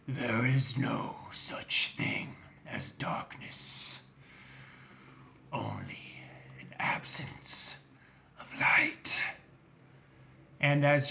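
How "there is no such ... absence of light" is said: slow, lower, softer whisper; eerie unseen voice. Acted. Unseen villain taunts the party, a slow eerie whisper. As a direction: breathe it out like a menace from the dark. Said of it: "and it says".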